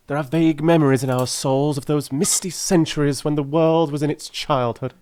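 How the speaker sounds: a keen detective carried away by his idea; Holmes voice. A narrator's reading. Narrator voices Holmes at dinner.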